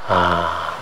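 usual speaking voice

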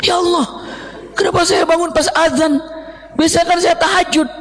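high, startled and half laughing; startled cry. Acted. Waking late to the call to prayer, startled. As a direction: jolt awake in alarm at missing the prayer.

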